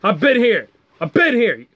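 high-pitched mock outrage at a kid; mock scolding. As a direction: scold an upstart in indignant bursts.

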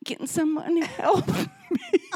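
high, giggling call for help; new mother laughing as she begs the nursery for help; laughing helpless plea. Retold or imitated. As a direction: laugh it out like you're lost. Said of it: "I go".

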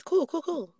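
lightly voiced old man; a hint of a grumpy old man. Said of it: "In my old man voice".

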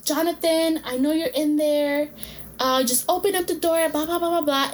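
half-hearted yell through the door; retold yelling. Ex-girlfriend yelling at Jonathan through the door, mild mock yell. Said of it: "this girl starts yelling".

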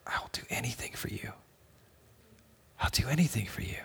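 soft breathy whisper of devotion; whispered vow. Retold or imitated. Father whispering to his kids that he'll do anything. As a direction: breathe it like a secret promise.

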